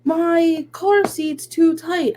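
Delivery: put-on high, very slow whiny kid complaint; toddler voice. Mom imitating her daughter complaining from the car seat. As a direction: whine it like a cranky toddler. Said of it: "And then she goes".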